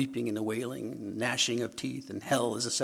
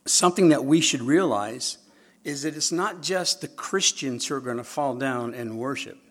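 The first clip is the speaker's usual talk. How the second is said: ordinary delivery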